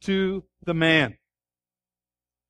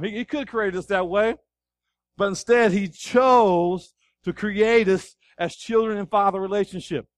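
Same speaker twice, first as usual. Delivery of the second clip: usual speaking voice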